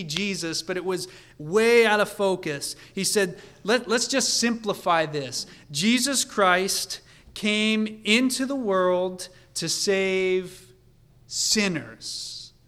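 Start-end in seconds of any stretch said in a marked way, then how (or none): 3.6-12.5 higher, louder emphatic declaration; worked up. Acted. Preacher acting Paul simplifying the message to its core, worked up. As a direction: proclaim it slowly and forcefully. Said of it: "Paul is getting worked up here".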